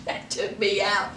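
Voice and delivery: low, soft, almost under her breath; dropped low and soft; quiet aside. Real, not acted. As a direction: murmur it to yourself, spent from laughing. Repeats punchline to herself.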